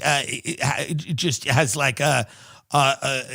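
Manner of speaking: normal talking voice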